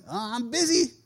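a lightly voiced mumbling teen; a hint of teen boredom; bored teenager. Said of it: "I hear this from thirteen-year-olds".

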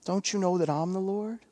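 soft, sugary, soothing Jesus voice; mock gentle Jesus. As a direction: coo it like a cozy picture-book Jesus. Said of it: "does not say something".

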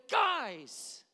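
high, loud retold shout; exasperated dad. Dad cutting off his kids' questions, exasperated. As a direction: call the kids up short, loudly.

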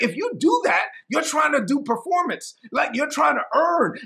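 high, insistent telling-off; exasperated preacher voicing a rebuke; frustrated. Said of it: "this is why it frustrated Paul".

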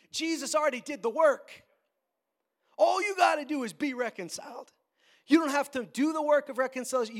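loud, high-pitched sermon appeal; forceful preaching. Real, not acted. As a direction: press the message on the congregation with force.

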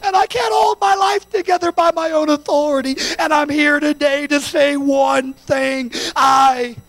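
impassioned preacher, pitched high; full-force preaching. Real, not acted. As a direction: build to a forceful altar call, stretching key words.

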